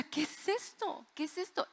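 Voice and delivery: high, hushed, frightened questioning; alarmed disbelief. Retold or imitated. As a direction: relive the dread, softly and high. Retelling her terror hearing screams.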